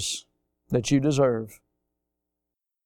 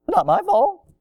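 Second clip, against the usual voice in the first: squeaky high denial; high-pitched mock protest of innocence; mock blame-dodger; 12 semitones higher than usual. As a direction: whine it way up high, mocking excuse-makers. Mimics people passing the blame.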